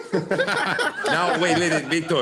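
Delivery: high, loud laughing protest. Real, not acted. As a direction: talk through a laugh. Bursts out laughing mid-sentence.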